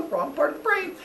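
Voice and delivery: high, sheepish remark; oops voice. Acted. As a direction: say it high, caught out. Hephaestus after leg kicks.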